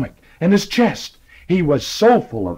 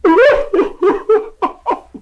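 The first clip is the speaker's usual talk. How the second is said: very high hearty laugh; big laugh; 4 dB louder than usual. Acted. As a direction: burst out laughing. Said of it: "He started to laugh".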